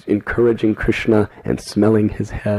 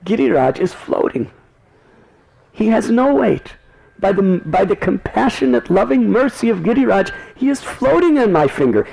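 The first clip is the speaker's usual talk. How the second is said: put-on high, cheerful boyish voice; smiling Krishna; 11 semitones higher than usual. Krishna cheerfully reassures his mother, high light voice. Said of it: "he smiled at his mother and said".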